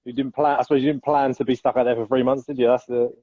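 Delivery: ordinary talk, nothing marked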